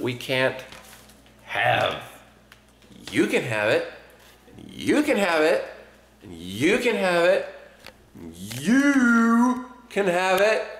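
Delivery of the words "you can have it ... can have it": rising higher, slower sing-song; each one higher, drawing it out; building sing-song. Real, not acted. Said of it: "but I can't have any".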